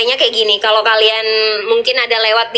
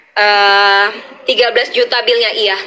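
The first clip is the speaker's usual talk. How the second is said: usual speaking voice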